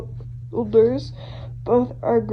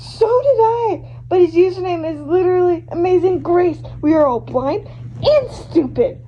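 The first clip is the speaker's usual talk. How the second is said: shocked exclamation; 5 semitones higher than usual. Acted.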